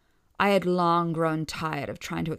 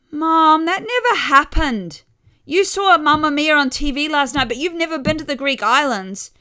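much higher, louder, firmly setting her straight; correcting sister; 10 semitones higher, 11 dB louder than usual. A narrator's reading. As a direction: younger sister insisting on the facts.

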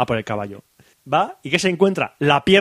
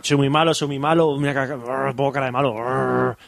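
cartoon villain; 5 semitones lower than usual. Friend acting out the greedy villain, hammed-up low voice. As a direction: ham up a greedy conquistador villain. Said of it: "Muy buena interpretación".

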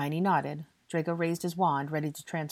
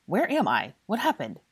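louder, urgent startled questions; frantic and disoriented; 5 semitones higher, 5 dB louder than usual. A narrator's reading. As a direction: ask in alarm. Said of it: "he asked frantically".

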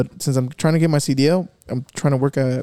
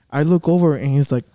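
ordinary delivery

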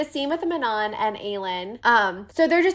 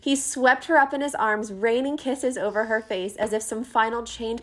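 reading aloud; 3 semitones lower than usual. Acted. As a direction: read it like a tender story. Reads book passage aloud.

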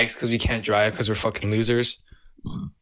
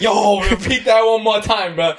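laughing request; 10 semitones higher, 5 dB louder than usual. Real, not acted. Listener begs storyteller to repeat the name, laughing loudly. Cracks up at the imitation.

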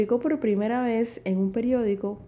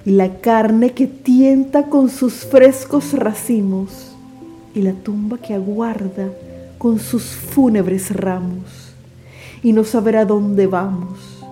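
grand poetry declamation; 9 dB louder than usual. Acted. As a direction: declaim with full dramatic force. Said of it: "declamaré para ustedes el poema".

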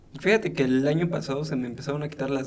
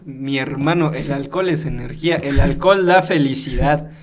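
mock sermon; 5 dB louder than usual. Preaching praise of alcohol.

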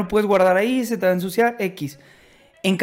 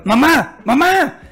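retold call, higher and louder; calling out for mom; 6 semitones higher, 4 dB louder, much slower than usual. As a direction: call out to grandma from across the house. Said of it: "le gri-, le habla a mi abuelita". (llamando a mamá a gritos, más agudo)

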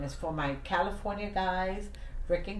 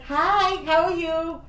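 much higher, louder, chirpy hello; bright cheery greeting; 9 semitones higher, 7 dB louder, much slower than usual. Retold or imitated. To a tired cashier, much higher cheery greeting. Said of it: "I was like".

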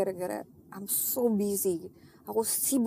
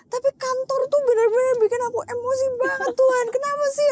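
much higher and louder, a retold tearful outcry; crying out to God; 14 semitones higher, 6 dB louder than usual. Crying alone to God about office stress, high and loud wail. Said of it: "nangis, nangis".

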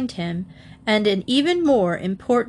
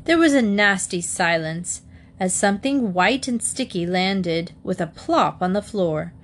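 plain reading voice

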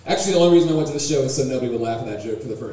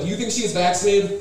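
ordinary talk, nothing marked

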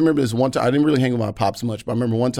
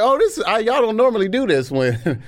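mock surprised high voice; 10 semitones higher than usual. His reaction to extra service.

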